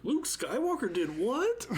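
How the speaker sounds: high-pitched, hammed-up astonishment; mock disbelief. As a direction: imitate a stunned gossip, voice shooting up. Voicing someone overhearing gossip on phone.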